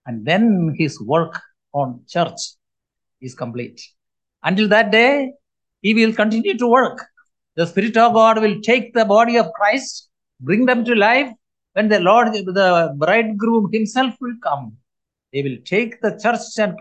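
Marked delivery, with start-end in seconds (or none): none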